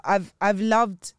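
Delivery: ordinary talk, nothing marked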